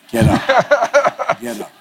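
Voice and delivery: high, laughing urging through a chuckle. Real, not acted. Retelling his dad's pep talk, laughing. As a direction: crack up while urging him up.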